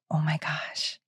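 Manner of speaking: breathy awed whisper, almost silent. Retold or imitated. Telling Logan about the psychic, awed whisper. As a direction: hush it like you can't believe it.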